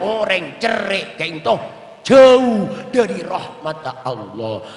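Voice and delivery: slow, loud sermon delivery, drawn out; fiery preaching. Acted. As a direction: thunder the warning to the congregation slowly. Preacher warns the congregation. (khotbah berapi-api, lambat dan keras)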